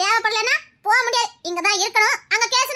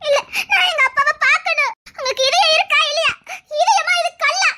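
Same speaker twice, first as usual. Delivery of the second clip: tearful, rising protest; crying out; 3 semitones higher than usual. Acted. Girl asking if he has a heart, crying out. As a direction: plead and accuse through sobs. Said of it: "இவ்வளவு கத்துற".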